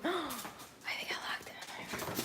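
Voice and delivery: gasped, breathy whisper of realization; hushed dismay after a gasp; shocked whisper. Real, not acted. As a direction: whisper it in horror. Gasp, realizes dog locked.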